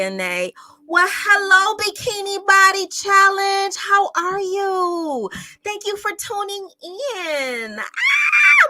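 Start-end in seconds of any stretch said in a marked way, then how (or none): 0.9-7.8 high, loud, delighted hello; squealing welcome to a favorite viewer; excited greeting. Real, not acted. 7.8-8.7 high, loud delighted shriek; a real joyful squeal; excited squeal. Real, not acted. Squeal of joy.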